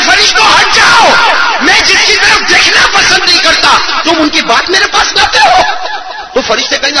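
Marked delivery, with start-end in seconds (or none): 0.1-5.6 high, shouted command of dismissal; stern rebuke. Acted. Allah sending the angels away, acted shouted rebuke. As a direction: thunder it out as an order from above.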